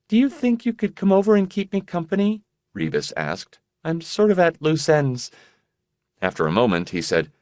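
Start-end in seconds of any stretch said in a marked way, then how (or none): 0.1-2.4 lightly voiced sick young woman, high; airy, distracted girlish voice; high fragile young woman. Rebus voiced by narrator. 3.8-5.3 lightly voiced sick young woman, high; airy, distracted girlish voice; high fragile young woman. Rebus voiced by narrator.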